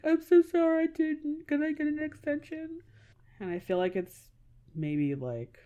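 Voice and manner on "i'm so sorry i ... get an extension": higher, pleading student begging a teacher; student timidly asking a teacher for an extension; sheepish plea. Pretend. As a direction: sound apologetic and small, asking for more time. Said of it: "be able to be like".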